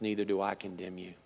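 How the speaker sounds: soft, low, tender reassurance; hushed voice, granting mercy; gentle pardon. Retold or imitated. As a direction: speak forgiveness quietly and gently. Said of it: "Jesus says something beautiful that only God can say".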